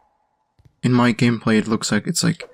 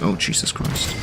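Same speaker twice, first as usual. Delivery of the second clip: whispered curse; 3 semitones lower, 6 dB quieter than usual. Real, not acted. Near miss in the game.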